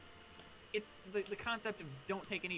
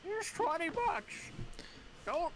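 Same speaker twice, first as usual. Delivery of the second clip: put-on old man; 14 semitones higher, 5 dB louder than usual. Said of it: "whatever old people say".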